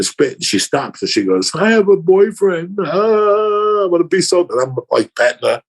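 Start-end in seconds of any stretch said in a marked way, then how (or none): 1.5-4.4 higher, drawn-out mock weepy whine; mock crying. Date stops kissing to confess a boyfriend, mock crying. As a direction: imitate a girl slowly wailing her confession. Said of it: "They're crying, like".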